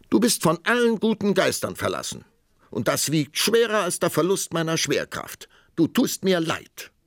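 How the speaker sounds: put-on high, loud, indignant young princess. A narrator's reading. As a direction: scold him in a high royal huff.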